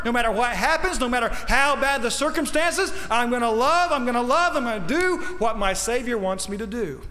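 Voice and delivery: high, rising vow, building in fervor; impassioned preaching. Acted. Preacher speaking as charity vowing to love, impassioned.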